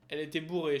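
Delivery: usual speaking voice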